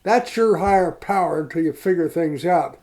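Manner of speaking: imitating a fed-up sponsor; mock-angry question to the drunk; blunt retold demand. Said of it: "blunt from the heart".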